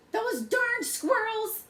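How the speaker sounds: put-on high, slow cranky old man shouting; cranky old codger yelling at squirrels; grumpy old man yell. A narrator's reading. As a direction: shake your fist and holler like a grouchy old man. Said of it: "yelled Old Man Fuquire".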